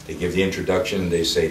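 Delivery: ordinary delivery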